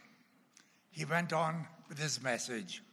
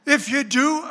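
high, loud laughing warning; loud joking warning; 9 semitones higher, 12 dB louder than usual. Real, not acted. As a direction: warn them loudly with a laugh.

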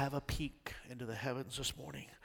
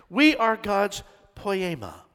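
slow, louder unison line; congregation repeat; 8 semitones higher, 11 dB louder, slower than usual. Shown as a demonstration. Preacher leading the church to repeat, louder and slow. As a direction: say it together, slowly. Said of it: "Can you say it with me?".

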